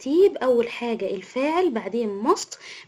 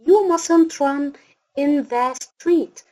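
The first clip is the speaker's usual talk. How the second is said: very slow, a little louder, word by word; slow dictation; 2 semitones higher, 4 dB louder, much slower than usual. Shown as a demonstration. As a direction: spell out the example for a child. Teacher dictating example sentence.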